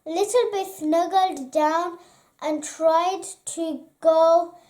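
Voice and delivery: usual speaking voice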